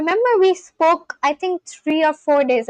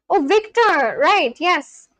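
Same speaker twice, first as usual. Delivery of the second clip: usual speaking voice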